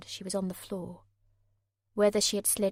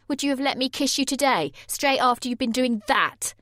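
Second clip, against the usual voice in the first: loud, raised accusing question; jealous boyfriend; 6 semitones higher, 9 dB louder than usual. Acted. Jay confronting Sammy about the photo, loud. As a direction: throw the question at her.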